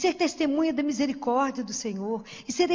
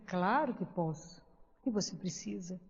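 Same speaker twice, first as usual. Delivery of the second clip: gentle reassurance; 6 semitones lower, 10 dB quieter than usual. Retold or imitated. Answering a homeless man who asks for blessing, gentle. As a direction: offer help tenderly.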